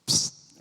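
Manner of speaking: unvoiced hiss to call a friend; gossip's psst. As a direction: hiss for attention.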